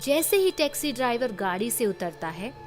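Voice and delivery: ordinary delivery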